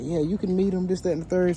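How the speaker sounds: imitating eager owner.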